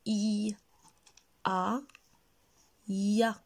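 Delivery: "I", "a", then "ya", each sound set apart. Shown as a demonstration. Teacher reads for children to repeat, sounding out "I, a, ya". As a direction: say "I", pause, "a", pause, then blend into "ya". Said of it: "je vais lire et vous allez répéter".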